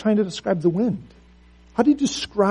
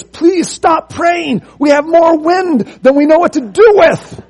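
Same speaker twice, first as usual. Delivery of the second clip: much higher, louder, drawn-out plea; frantic pleading; 11 semitones higher, 9 dB louder than usual. Acted. Captain at the door begging Taylor, frantic high plea. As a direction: beg him to stop, overwhelmed.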